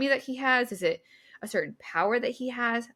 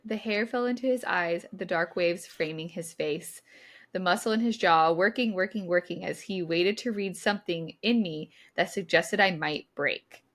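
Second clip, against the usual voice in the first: reading aloud from the book; 3 semitones lower than usual. Acted. S0 reads the novel passage to her co-host, measured narration.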